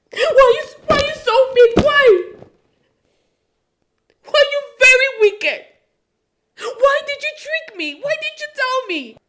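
high, loud tearful accusations; shouting through tears; crying angry outburst. Acted. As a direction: wail at him in betrayal. Wife breaking down, accusing husband.

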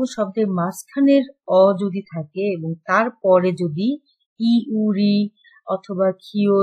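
ordinary talk, nothing marked

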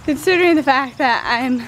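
panting between words after jumping; breathless, winded from the workout; out of breath. Real, not acted. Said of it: "I'm out of breath".

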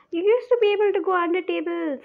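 high, loud frustrated complaint; yelling at the racetrack. Real, not acted. Frustrated while hiding from beast.

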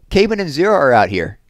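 loud, high call to the others; shouting. A narrator's reading. Squid telling the boys in the rec room, shouting. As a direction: call back into another room. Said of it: "Squid shouted back into the rec room".

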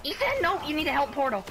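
high panicked yell; shouting orders over battle. Real, not acted. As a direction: cry out in alarm to teammates. Teammate about to die.

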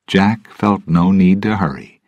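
ordinary delivery

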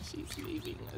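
soft whisper so nobody wakes. Real, not acted. Filming sleeping friends, hushed whisper. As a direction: breathe the words, barely voiced. Quiet goodbye to her girlfriend.

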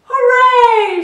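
high joyful shout. A narrator's reading. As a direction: cheer at the top of the lungs. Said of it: "shouted Yertle".

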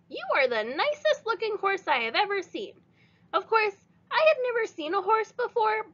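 put-on high, bright girl's introduction; little girl voice. A narrator's reading. Stella introduces herself to Leroy, high child voice. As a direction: pitch it up like a small girl. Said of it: "looked Maybelline in the eye and said".

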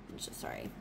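sheepish whisper with no voice; whispered apology. Real, not acted. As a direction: whisper a quick embarrassed sorry. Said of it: "My bad, you guys".

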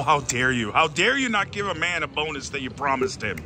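ordinary delivery

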